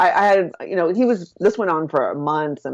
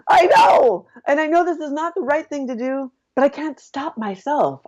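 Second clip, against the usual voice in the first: tearful outburst; 9 semitones higher than usual. Retold or imitated. Woman crying out to her business partner, tearful and desperate. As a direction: break down admitting you can't stop.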